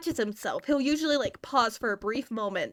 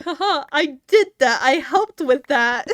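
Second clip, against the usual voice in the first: louder, higher playful boast with a ha-ha; mock gloating; 3 semitones higher, 9 dB louder than usual. Streamer gloats to chat about coaching his accent, mock gloating. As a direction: crow proudly over your handiwork.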